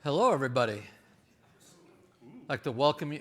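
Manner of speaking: loud projecting parade-ground voice; Marine command voice. Shown as a demonstration. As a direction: project it to the back row like a Marine officer. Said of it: "this is, uh, me projecting my voice".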